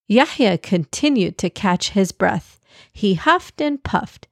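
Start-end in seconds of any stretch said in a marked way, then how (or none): none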